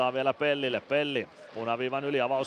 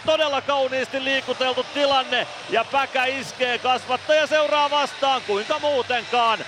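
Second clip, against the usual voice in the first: soaring, loud goal call, much higher; 13 semitones higher, 7 dB louder than usual. Real, not acted. Commentator screaming the goal to the listeners. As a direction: erupt as the home team scores.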